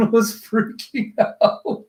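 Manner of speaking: slow, laugh-broken words; words dissolving into laughter; laughing while talking. Real, not acted. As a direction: talk through real laughter. Cracking up at the story.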